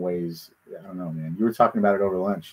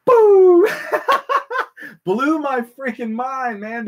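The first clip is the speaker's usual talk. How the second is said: high, loud burst into laughter; laughing burst; 5 dB louder, much slower than usual. Real, not acted. Recalling the yoga instructor's line to his co-host, bursting into high laughter. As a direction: explode into laughing disbelief, then slow down.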